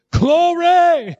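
very high, drawn-out cry of praise; jubilant praise. Real, not acted. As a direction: sing out the praise high to the congregation. Preacher cries out praise.

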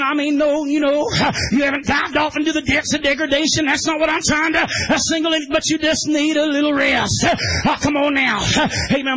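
chanted preacher's cadence, half shouted; whooping holiness sermon; preaching at full force. Real, not acted. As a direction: drive it home like a revival preacher.

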